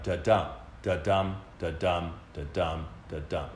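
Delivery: "da-dum" with the second beat punched each time; iambic da-dum rhythm. Shown as a demonstration. Teacher showing students the iambic beat, stress on "dum". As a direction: tap out "da-dum" as a steady iamb, light then strong. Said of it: "it has the pattern of".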